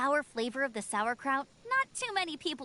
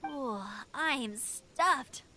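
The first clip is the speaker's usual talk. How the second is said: slow, drawn-out sigh of being full; stretched-out satisfied groan; contented and full; much slower than usual. Acted. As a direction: savour every word after a big meal. After finishing the meal.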